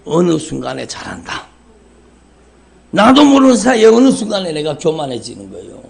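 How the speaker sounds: ordinary talk, nothing marked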